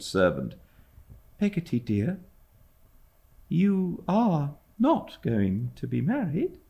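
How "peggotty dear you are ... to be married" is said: put-on anxious young mother. A narrator's reading. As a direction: ask it gently, afraid of the answer.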